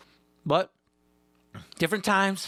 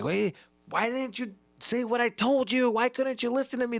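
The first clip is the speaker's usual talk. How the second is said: higher voice of a scolding parent; angry parent; 7 semitones higher than usual. Retold or imitated. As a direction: scold the found kid, lightly voiced. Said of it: "You're just like".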